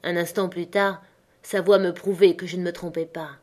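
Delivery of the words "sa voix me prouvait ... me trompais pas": plain reading voice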